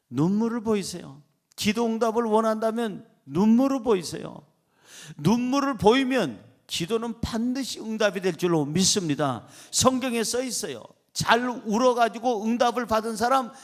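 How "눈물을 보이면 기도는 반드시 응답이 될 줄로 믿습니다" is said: ordinary talk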